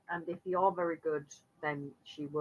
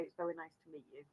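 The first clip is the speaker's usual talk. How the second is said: put-on quick, soft, friendly hello to a child; soft greeting; 2 semitones lower, 15 dB quieter, much faster than usual. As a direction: greet her gently and briskly. Tiefling greets shy little girl.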